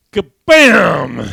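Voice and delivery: explosive, very loud kabam; one big booming mock shot; imitated gunshot. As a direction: burst out high and loud, like a blast. Imitates the Cajun's single shot.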